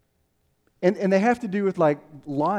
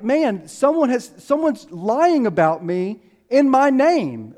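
slow, loud, high-pitched outrage; acting out someone wrongly accused; indignant, drawn-out complaint; angry; 7 semitones higher, 6 dB louder, slower than usual. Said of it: "And he's angry".

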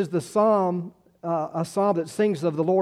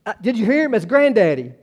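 higher, louder, proud excitement; excited grandpa; 6 semitones higher, 8 dB louder than usual. Retold or imitated. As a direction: crow it like the baby just said your name. Grandfather thrilled by baby's word.

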